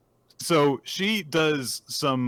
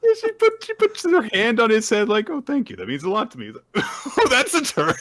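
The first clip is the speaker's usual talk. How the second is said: high, giggly sing-song voice; laughing high voice; 11 semitones higher, 5 dB louder than usual. Retold or imitated. Toshusai patting his head, high and giggly. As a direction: play it squeaky and amused.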